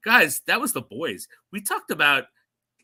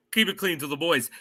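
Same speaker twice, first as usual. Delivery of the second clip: usual speaking voice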